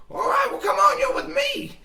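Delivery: very high, loud squeal; rally a companion in a squeaky shout; eager Meat Shield voice. Acted. Meat Shield recruits the player, shouting.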